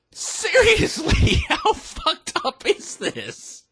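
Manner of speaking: high, drawn-out disbelief breaking into laughter; incredulous laughing. Real, not acted. Speaker reacting to own skit, laughing in disbelief. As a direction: squeal it slowly through a laugh.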